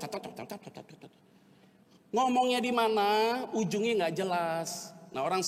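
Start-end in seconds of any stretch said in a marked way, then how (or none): 0.0-1.1 rapid "tat-tat" babble, trailing softer; mock chatter. Preacher mimics how a sanguine person talks, rapid soft chatter. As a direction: imitate someone rattling on without thinking. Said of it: "Orang sanguin kalau ngomong".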